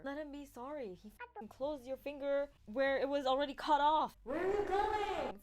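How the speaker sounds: building to a high, loud shout; yelling. Acted. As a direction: escalate into a hammed-up holler. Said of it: "Felix yells".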